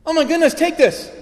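high, loud gushing concern; concerned girl. Retold or imitated. Young girl offering her umbrella to a soaked stranger, high and gushing. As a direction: squeal it like an alarmed young girl. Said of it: "She goes".

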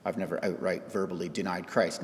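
low, soft, arms-crossed grumble; mild defensive grumble; defensive skeptic. Retold or imitated. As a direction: mutter it like a doubter with arms crossed. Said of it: "cross your arms right now and be like".

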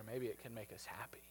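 soft unvoiced whisper of hope. Coach whispers to crying Charlotte, barely voiced and tender. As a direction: breathe it to her gently. Tender close to comforting story.

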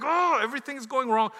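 loud, high despairing complaint; hammed-up cry of dismay; exasperated wail. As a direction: act out mounting panic loudly. Acted panic as things go wrong.

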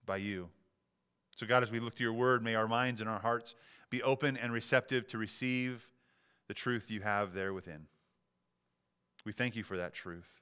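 low, measured congregational prayer. Real, not acted. Pastor praying for open hearts before the sermon, prayer.

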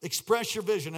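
loud, high, impassioned preacher; rally the congregation at full voice; full-force preaching. Real, not acted.

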